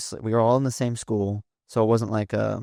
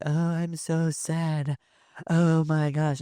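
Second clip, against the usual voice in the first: high, slow, whiny mock of a sad girl; 7 semitones higher, slower than usual. Mocking the girl posting her sad breakup. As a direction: overact the sad breakup post, drawn out. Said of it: "being like".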